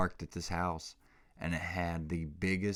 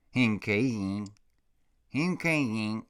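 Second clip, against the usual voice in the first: put-on baby talk; 4 semitones higher, 5 dB louder than usual.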